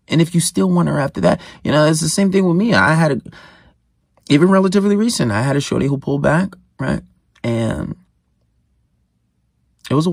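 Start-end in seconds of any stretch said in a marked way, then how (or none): none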